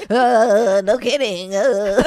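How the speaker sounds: over-caffeinated outburst. Retold or imitated. Retelling her first cold brew, mock over-caffeinated outburst. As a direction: blurt it out like a caffeine jolt hit. Acts out caffeine jolt, coffee story.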